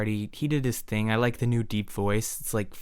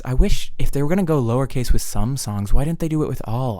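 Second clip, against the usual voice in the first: ordinary talk, nothing marked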